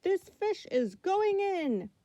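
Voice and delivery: high, loud yelled order. Acted. Fireman yells at swimmers to leave the pool. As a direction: clear the pool with a high shout. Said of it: "The fireman yelled".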